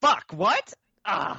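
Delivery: high, loud shocked outburst. Real, not acted. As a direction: blurt it out in disbelief. Told there are two French accents.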